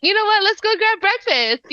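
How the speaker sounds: very high, bright suggestion; chirpy. Retold or imitated. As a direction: pitch it way up, eager. Quoting her own eager past self.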